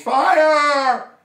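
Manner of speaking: high, loud drawn-out yell; alarm shout. Acted. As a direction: belt it out like a mock fire alarm. Shouts the case's catchword.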